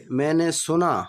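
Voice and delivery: usual speaking voice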